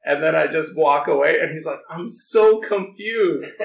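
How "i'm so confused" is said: imitated bewilderment, high and very drawn out; louder, slow mock confusion, stretching the "so"; bewildered. Imitating office guy left after a hug, bewildered. Retold reaction to surprise hug.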